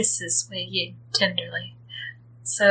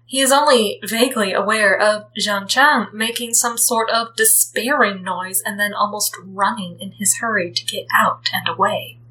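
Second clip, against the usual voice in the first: ordinary delivery, nothing marked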